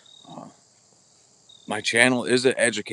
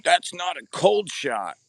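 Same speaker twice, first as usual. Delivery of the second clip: slow, higher, snooty mimic of a nitpicker; a mock-prissy correction, pitched up and slowed; mocking the commenter; 7 semitones higher, slower than usual. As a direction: drag it out like a know-it-all correcting you. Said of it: "somebody got their panties in a wad".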